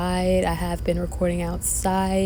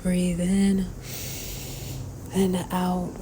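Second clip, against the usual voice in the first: guided meditation. Acted. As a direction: guide the breath calmly. Leads a breathing meditation.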